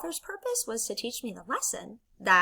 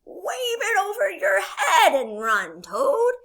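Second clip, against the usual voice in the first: high, loud, stretched-out urging; rallying storybook frog; Frog character voice; 10 semitones higher, 7 dB louder, slower than usual. Acted. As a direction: call out slow, eager instructions. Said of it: "He says".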